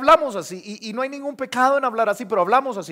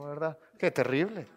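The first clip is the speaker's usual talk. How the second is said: ordinary talk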